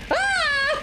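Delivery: high shriek into giggles; squealing laugh. Real, not acted. Laughing at own joke.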